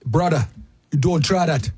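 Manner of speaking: hammed-up Hawaiian accent; terrible Hawaiian accent. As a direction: do a badly faked Hawaiian local. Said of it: "That's my terrible Hawaiian accent".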